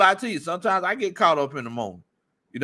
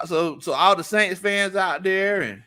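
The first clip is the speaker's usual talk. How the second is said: ordinary talk, nothing marked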